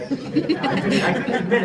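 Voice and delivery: high, laughing confession; laughing while owning up. Real, not acted. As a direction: laugh through the admission. Laughing admission about own talent.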